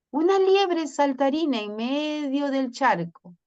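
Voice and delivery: ordinary talk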